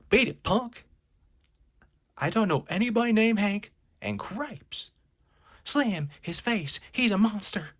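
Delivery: high, loud panicked brush-off; frightened shriek. Acted. Miss Destiny shrieking at the furry intruder in fright. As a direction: shriek at the monster to get lost.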